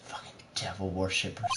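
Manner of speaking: hushed whisper; whispered aside. Retold or imitated. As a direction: breathe it out in a whisper. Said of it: "Even Edgeworth is like".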